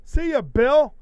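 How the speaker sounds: high, loud singsong hello; loud, high cheery mock greeting; Fiend's cheery greeting. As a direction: chirp it like a creepy kids' host. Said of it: "We like".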